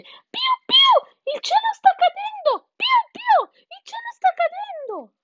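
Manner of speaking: very high, loud squeaky chick; cheeping chick. A narrator's reading. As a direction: cheep the alarm in a tiny panicked voice. (pigolio acutissimo di pulcino)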